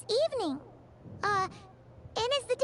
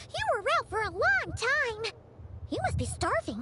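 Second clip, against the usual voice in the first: usual speaking voice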